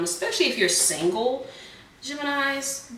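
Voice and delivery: ordinary talk, nothing marked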